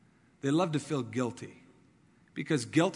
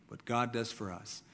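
quiet echo; 4 semitones lower, 7 dB quieter than usual. Real, not acted. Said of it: "But the emphasis is what God does for us".

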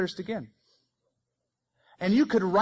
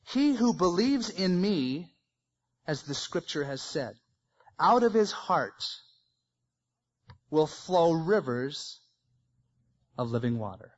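slow solemn reading. Shown as a demonstration. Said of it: "notice verse thirty-eight".

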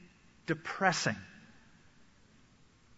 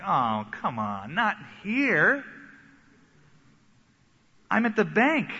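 reluctant protest; 4 semitones higher, slower than usual. Retold or imitated. Reluctant believer answering the Spirit.

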